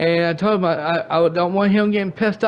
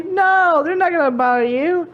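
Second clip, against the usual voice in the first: mock high voice; 10 semitones higher than usual. Mocking Zombie's reassurance.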